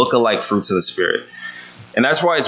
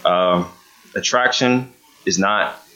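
ordinary talk

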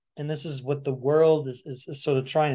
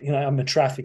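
normal talking voice

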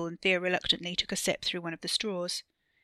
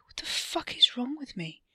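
harsh whisper, higher pitched; self-reproaching whisper; 6 semitones higher than usual. A narrator's reading. Wesley berates himself for blurting it out, whispering. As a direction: whisper it in disbelief at yourself.

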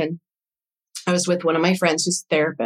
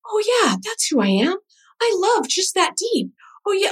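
delighted recognition; 13 semitones higher than usual. Retold or imitated. Sudden recognition in the vision.

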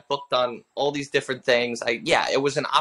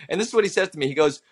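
ordinary talk, nothing marked

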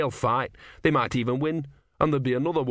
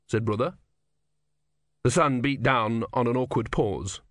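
ordinary delivery

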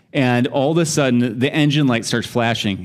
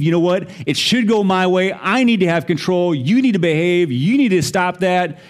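demanding; 6 semitones higher than usual. Retold or imitated. Voicing a proud heart that wants control, demanding. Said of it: "Or are we gonna believe".

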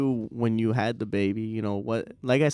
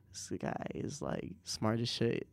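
hushed awe; 12 dB quieter than usual. Retold or imitated. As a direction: murmur it to yourself, impressed. Voicing his own impressed thought.

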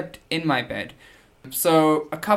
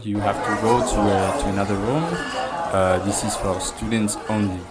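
put-on French accent; 5 semitones lower than usual. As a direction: put on a heavy French accent, apologetic but firm. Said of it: "that's my French accent".

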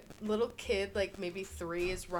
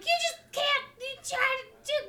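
put-on much higher, louder screeching kid voice; loud kid impression; 5 dB louder than usual. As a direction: keep shrieking like the enraged kid. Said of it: "gonna talk probably pretty loud".

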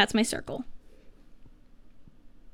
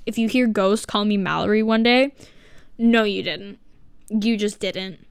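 ordinary talk

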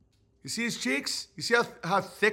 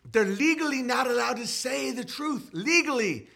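high, stretched put-on voice; 6 semitones higher than usual. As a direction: raise it into an impersonated complaint. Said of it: "If [name] was like".

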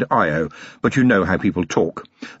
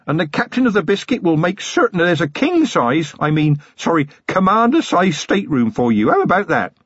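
put-on lightly raised, eager young voice; a keen young constable showing off; Feeney, eager young copper; 8 semitones higher than usual. Young copper offering the commander a stateroom, eager.

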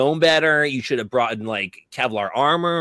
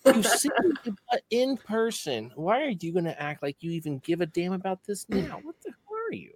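mock scolding fan; 7 semitones higher, 5 dB quieter than usual. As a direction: scold like an offended follower.

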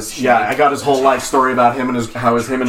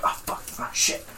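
imitating hushed, panicked cursing; retold whispered muttering of curses; whispering under breath; 12 dB quieter than usual. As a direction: swear to yourself in a whisper. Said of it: "like, whispering under his breath".

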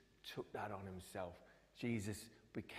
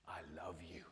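soft, tender whisper; 4 semitones lower, 7 dB quieter than usual. Acted. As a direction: whisper it with love. Jesus voiced tenderly.

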